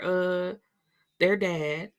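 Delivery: ordinary talk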